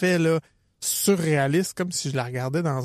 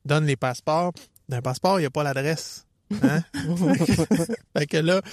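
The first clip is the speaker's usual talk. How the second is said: ordinary talk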